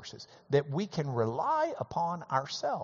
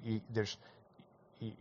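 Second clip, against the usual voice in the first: normal talking voice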